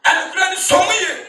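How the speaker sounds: fiery sermon shout; preaching. Acted. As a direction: proclaim it to the whole church.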